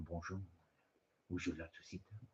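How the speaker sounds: put-on low, hushed French accent. Imagined first date in a French accent, low and soft. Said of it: "had done the French accent".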